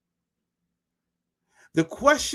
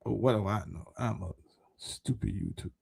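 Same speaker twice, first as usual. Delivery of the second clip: sarcastic aside; 9 semitones lower, 10 dB quieter, faster than usual. Real, not acted. Sarcastic self-putdown after long pause.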